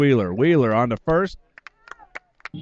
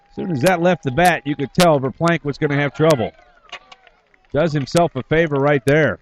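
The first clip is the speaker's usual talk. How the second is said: loud, high home run call; excited play-by-play; 7 semitones higher, 8 dB louder than usual. Real, not acted. Announcer tells listeners a home run was surrendered, excited play-by-play. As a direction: call the homer at full voice.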